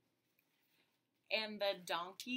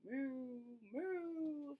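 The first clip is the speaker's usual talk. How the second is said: cow moo; 5 semitones higher, 3 dB quieter than usual. Shown as a demonstration. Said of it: "the cow will say".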